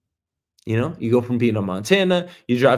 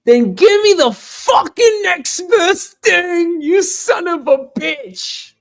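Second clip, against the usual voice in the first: very high, stretched-out mock screaming; furious shout; 6 dB louder, slower than usual. Customer told they have no crullers, hammed-up screaming rage. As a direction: explode at the clerk, voice pitched way up. Acted outburst at bakery staff.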